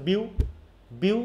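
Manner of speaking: "বিউ" said by itself with force, then repeated; stressed syllable alone. Shown as a demonstration. Teacher picking out the forced syllable for pupils, "বিউ" stressed. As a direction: isolate and press "বিউ". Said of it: "বিউতে না বেশি force হচ্ছে".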